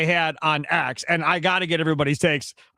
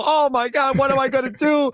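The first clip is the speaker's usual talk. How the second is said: higher, hammed-up despairing whine; mock crying; 5 semitones higher than usual. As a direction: pretend to wail in helpless panic. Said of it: "cry and go".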